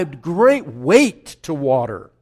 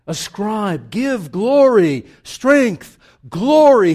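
slow, loud and higher proclamation; slow, rising preacher's call to give glory; proclaimed loudly; 4 semitones higher, 8 dB louder, slower than usual. Shown as a demonstration. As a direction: ring each word out like a psalm cry. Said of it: "when you wanted to emphasize, you multiplied the word".

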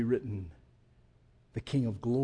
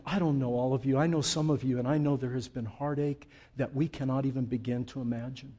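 usual speaking voice

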